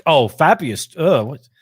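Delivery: imitated indignant disbelief; upset. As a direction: act put out and disgusted, hammed-up. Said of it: "is very upset when he's like".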